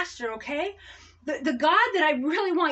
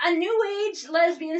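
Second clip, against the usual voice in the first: ordinary talk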